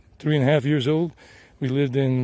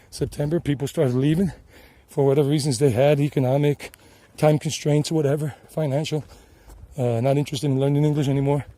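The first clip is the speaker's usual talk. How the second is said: ordinary talk, nothing marked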